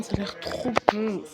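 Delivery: ordinary talk, nothing marked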